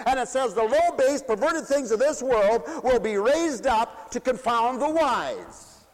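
high, loud, ringing revival preaching; full-force preaching. Acted. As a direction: preach it out to the whole hall.

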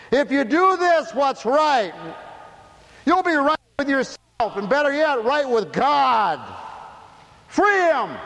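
high, loud crowd cry; yelling. Retold or imitated. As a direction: call it out like a pleading crowd. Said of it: "They could have yelled".

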